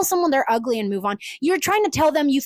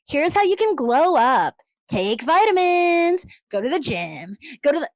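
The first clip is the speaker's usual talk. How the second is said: mock glow-up influencer. Influencer listing glow-up tips, mock presenter voice. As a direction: parody a chirpy advice video. Mocks glow-up advice videos.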